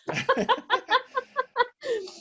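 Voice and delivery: high, teary laugh trailing down; emotional laugh. Real, not acted. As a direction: laugh while fighting tears. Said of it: "So yes, I'm getting emotional".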